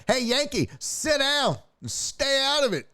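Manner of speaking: high, bossy mock warning; lightly voiced tough guy. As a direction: act out telling him off. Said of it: "You're like".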